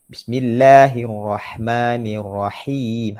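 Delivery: slow, measured Quran recitation; teacher's drawn-out model verse. Shown as a demonstration. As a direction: recite with long held vowels.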